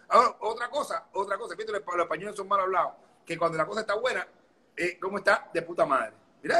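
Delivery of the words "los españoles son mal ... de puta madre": ordinary talk, nothing marked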